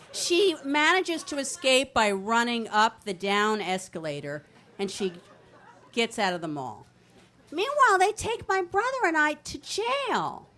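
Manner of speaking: usual speaking voice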